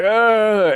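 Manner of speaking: loud pained grunt; pained ugh. Retold or imitated. Rider stumbling onto the mound, a loud grunt. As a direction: let out a frustrated ugh. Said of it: "I'm like".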